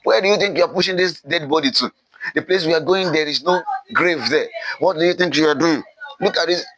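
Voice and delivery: high-pitched, hurried scolding; indignant rebel outburst, fast at the start; erupting in anger. Acted. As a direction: berate someone in a rush, pitch well up. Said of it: "Then the rebels erupt".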